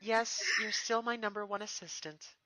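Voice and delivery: high, slow, loving reunion; fond princess. Acted. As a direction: pour out how much you missed him. Twilight confirms.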